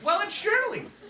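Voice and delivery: very high, loud mom voice; imitated mom's high-pitched reveal; bright-idea mom impression. Mom reveals the yard's owner.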